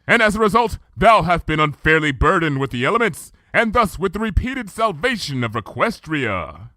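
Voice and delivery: measured, archaic royal speech; Luna's regal voice. Acted. Luna recounting the past to Twilight, stately.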